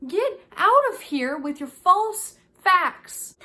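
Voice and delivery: mock outrage. Real, not acted. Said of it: "Ben is not the historian".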